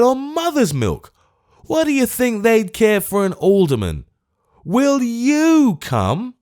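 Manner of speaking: high, loud incredulous pleading; exasperated. Acted. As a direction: plead in comic outrage, dragging the last words. Old man's exasperated plea.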